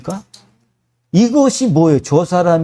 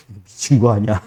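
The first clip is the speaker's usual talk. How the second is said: mock reaction; 6 semitones lower than usual. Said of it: "이렇게 되더라는 거예요".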